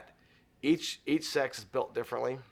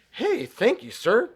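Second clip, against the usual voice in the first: high, loud, cheerful reply; bright-eyed thanks, pitched way up; retold delighted thanks; 12 semitones higher, 10 dB louder, slower than usual. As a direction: imitate an upbeat, grateful stranger. Retold friendly exchange at the door.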